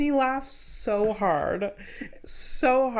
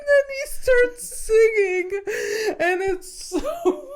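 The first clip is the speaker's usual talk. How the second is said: high, loud, laughing, almost crying; can barely get it out for laughing; helpless laughter; laughing hard; 13 semitones higher, 5 dB louder, slower than usual. Real, not acted. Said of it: "laughing hard retelling".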